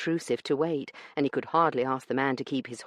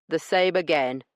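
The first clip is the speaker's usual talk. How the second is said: a smug weasel of a man brushing her off; rough dealer; 3 semitones higher, 4 dB louder than usual. Acted. Branson dismissing Io's complaint, smug and rough.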